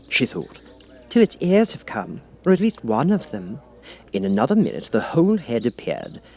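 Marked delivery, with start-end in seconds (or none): 1.1-3.6 put-on a young girl thinking aloud; Alice voice. Alice waits for the Cat's ears, girlish musing.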